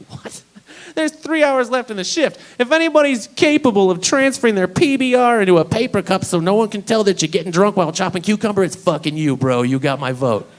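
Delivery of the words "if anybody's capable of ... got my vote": usual speaking voice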